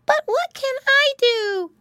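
put-on very high, loud toddler squeak; littlest pig. A narrator's reading. Baby pig wanting a job, squeaky pleading question. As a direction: pipe up like the baby of the family. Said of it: "asked Baby Pinky, the littlest pig".

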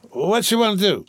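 put-on drunk. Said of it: "he queried suddenly".

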